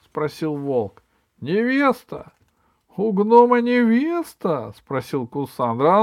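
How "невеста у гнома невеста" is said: high, loud drawn-out disbelief; loud astonishment. Acted. Villains learn the gnome has a bride, loudly incredulous. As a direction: crow it incredulously, stretching the words.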